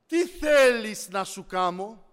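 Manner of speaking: loud, slow, stretched-out question; emphatic divine question. Retold or imitated. The Lord asking what to do for him, loud and deliberate. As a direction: proclaim it slowly to the whole room. Repeats the Lord's question forcefully.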